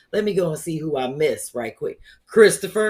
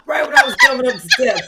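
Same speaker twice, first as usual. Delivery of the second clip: laughing; 7 dB louder, faster than usual. Real, not acted. Laughing at her own story.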